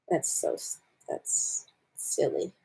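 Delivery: breathy whisper, slow and soft; whispered aside. Real, not acted. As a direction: whisper it to yourself, unimpressed. Muttered aside after bad joke.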